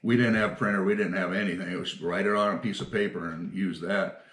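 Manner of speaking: usual speaking voice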